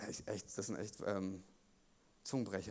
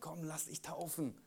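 much softer, gentle call; soft invitation; 8 dB quieter than usual. Acted. God inviting the listener to baptism, softly. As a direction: invite them tenderly to baptism. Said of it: "wie er unsere Täuflinge gerufen hat".

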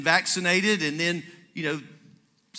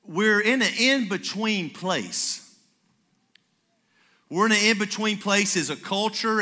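slow, weighty preaching delivery; emphatic preaching; 4 semitones higher than usual. Acted. Pastor preaching to the congregation, slow and emphatic. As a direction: lay each phrase down slowly.

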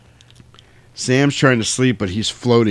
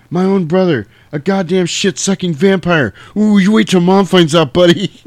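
Sam's line shouted high and loud; acted outburst; 8 semitones higher, 6 dB louder than usual. Kid brother yelling at vampire brother, loud and high. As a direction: belt the kid's accusation up high. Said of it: "That's one of the greatest lines in movie history".

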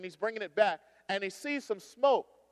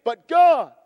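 sudden loud high cry of but God; a preacher's rising shout; 5 semitones higher, 11 dB louder than usual. Real, not acted. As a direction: burst out with God, full force. Preacher exclaims God's power.

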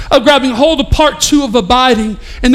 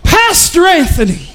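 greeter calling out; 7 semitones higher than usual. Retold or imitated. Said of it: "I hear somebody go".

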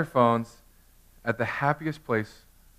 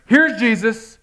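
much higher, louder exclamation; exclaiming Paul; 10 semitones higher, 9 dB louder, slower than usual. Retold or imitated. Paul announcing Jesus to the crowd, shouted. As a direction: proclaim it triumphantly. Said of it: "He's like".

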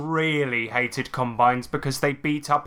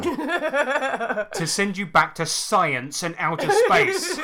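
laughing; 9 semitones higher, much slower than usual. Real, not acted. Reading silly line, cracking up.

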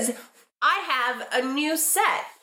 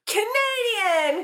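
slow, drawn-out excited cry; a game show host crying out the answer; excited shout; 8 semitones higher, much slower than usual. Acted. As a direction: announce it like a game show winner.

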